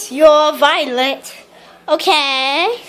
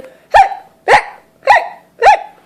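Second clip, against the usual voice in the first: rising, louder hiccup chain climbing high; string of hiccups; 12 semitones higher, 5 dB louder than usual. Acted. Aunt with the hiccups gives a rising hiccup chain. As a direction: hiccup four times, each jumping higher.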